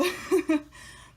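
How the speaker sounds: short breathy giggle; light laugh. Real, not acted. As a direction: laugh at yourself. Laughs at own remark.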